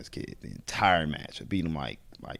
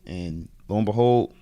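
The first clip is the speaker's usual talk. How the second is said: ordinary talk, nothing marked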